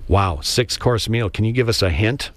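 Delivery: ordinary delivery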